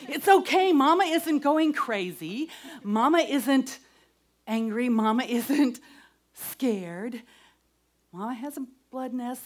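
put-on loud, laughing, emphatic reassurance to little kids; mother reassuring her young children, loud and laughing. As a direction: tell the kids brightly that Mama is fine. Explaining PMS to her small kids.

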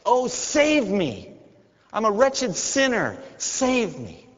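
high, slow, pleading cry; desperate cry. Shown as a demonstration. Preacher showing how to cry to God like a child, high and desperate. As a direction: beg like a child crying out. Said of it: "like a little child, cry out to God".

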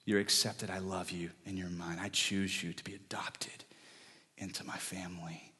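soft reassurance sinking to a whisper; tender fading to whisper. Acted. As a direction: start gentle, end in a breathy whisper. God's tender voice, fades to whisper.

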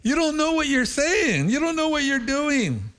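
high, urgent, laughing plea; urgent pleading. Retold or imitated. Pastor warning the eager Indian crowd, high and urgent. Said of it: "I tell them".

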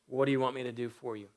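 a brisk, hushed asking; quick, soft question. Acted. Preacher reading Jesus' question to the beggar, quick and soft. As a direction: rattle it off quietly.

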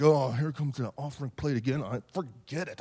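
low, grudging grumble; put-on sullen reluctance; mock reluctant giver. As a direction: grumble softly as if refusing to pay.

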